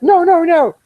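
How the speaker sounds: loud, very high-pitched frantic retraction; panicked protest. Retold or imitated. Job seeker desperately stopping the man withdrawing his offer, panicked. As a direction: blurt it high and loud, re-enacting desperate backpedalling.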